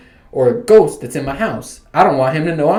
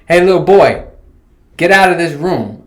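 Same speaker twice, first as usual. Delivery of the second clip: loud, higher-pitched order; mock ordering; 4 semitones higher, 6 dB louder than usual. Host imagines kicking little boy out of room, loud mock order. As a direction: shoo the kid out loudly.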